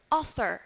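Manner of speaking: "Author" with an r-coloured ending; American rhotic R. Shown as a demonstration. Teacher repeats author for class, American R. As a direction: finish "Author" on "er". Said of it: "In American English".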